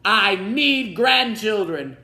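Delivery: high, loud, slow blustering king; king's voice. A narrator's reading. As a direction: bellow like an impatient old king. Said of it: "he told the Grand Duke".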